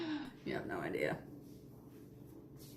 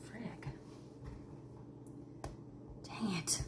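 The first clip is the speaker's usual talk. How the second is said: whispering; 3 semitones lower, 19 dB quieter, slower than usual. Real, not acted. Styling attempt goes wrong.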